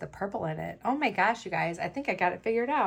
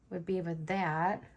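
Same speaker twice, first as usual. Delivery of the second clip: ordinary talk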